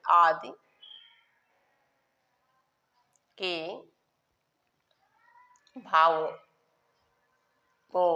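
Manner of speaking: word by word with long pauses; slow dictation. Shown as a demonstration. Teacher dictating a definition for pupils to write, slow dictation. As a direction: dictate in small chunks, waiting between them.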